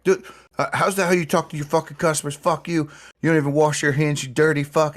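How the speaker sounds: hammed-up insult hurled at the hot dog vendor; mock angry yelling. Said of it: "screaming racial obscenities".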